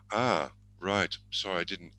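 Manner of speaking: normal talking voice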